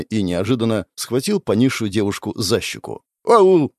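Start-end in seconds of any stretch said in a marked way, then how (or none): 3.2-3.7 sudden loud higher ouch; girl squeals when her cheek is grabbed; yelp of pain. Acted. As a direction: yelp as your cheek is pinched. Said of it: "айкнула она".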